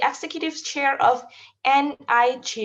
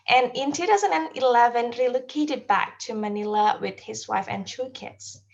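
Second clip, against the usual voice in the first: ordinary talk, nothing marked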